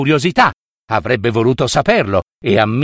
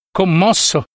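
plain reading voice

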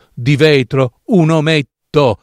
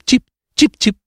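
high bird chirps, imitated; swallow chirping; 11 semitones higher than usual. The narrator imitates the swallow arriving, high chirping. Si sentì d'improvviso cip.

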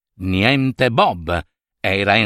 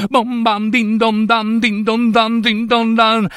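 high, ringing bell chant; imitated church bells; 9 semitones higher than usual. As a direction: peal like Christmas bells, joyfully. Imita le campane a festa. (imitazione festosa delle campane)

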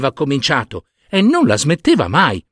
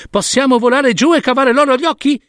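much higher, lightly voiced little storks asking; eager chicks; 12 semitones higher than usual. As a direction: pipe up like excited chicks. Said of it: "chiesero i cicognini".

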